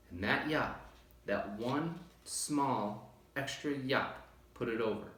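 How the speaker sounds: usual speaking voice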